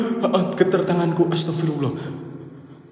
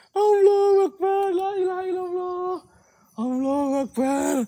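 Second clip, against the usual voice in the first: frightened crying prayer; 13 semitones higher, 4 dB quieter than usual. Real, not acted. Scared explorer crying out a prayer. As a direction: cry out to God in fear.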